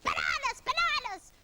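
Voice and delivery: high, chirpy parrot repeat; Parrot twittering. A narrator's reading. Parrot echoing cross Iggy, high twitter. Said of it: "twittered Parrot".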